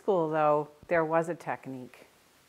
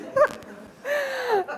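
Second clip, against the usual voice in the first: high giggling laugh; 6 dB louder than usual. Real, not acted. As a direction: laugh at your own confusion. Laughing at own reaction.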